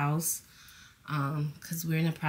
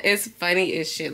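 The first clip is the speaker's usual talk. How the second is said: ordinary delivery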